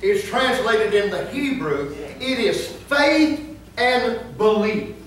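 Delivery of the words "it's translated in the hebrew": ordinary delivery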